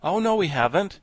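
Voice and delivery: lightly acted protest. A narrator's reading. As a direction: object firmly in character. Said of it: "protested the Scarecrow".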